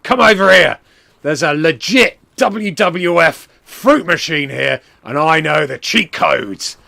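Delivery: wrestling-promo bellow; shouting wrestler. Retold or imitated. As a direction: bark orders like a hyped wrestler. Wrestler angrily overrules the narrator.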